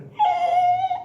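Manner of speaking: very high shrieking laugh. Real, not acted. Remembering the backwards stair scene, shrieking laugh. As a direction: squeal with shocked laughter.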